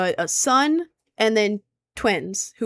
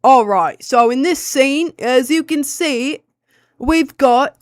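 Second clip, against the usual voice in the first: mock Australian accent; 4 semitones higher, 7 dB louder, slower than usual. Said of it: "I'm awful as".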